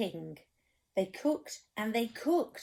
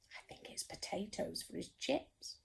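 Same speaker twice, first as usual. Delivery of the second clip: whispered aside to the listener; 11 dB quieter than usual. Real, not acted. Storyteller whispering a guess to the child. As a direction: let them in on a secret. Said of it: "whispered aside".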